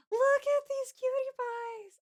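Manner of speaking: high, drawn-out squeal of adoration; adoring coo. Real, not acted. Streamer cooing over cute Pokemon in fan art, adoring coo.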